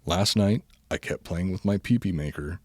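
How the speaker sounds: ordinary delivery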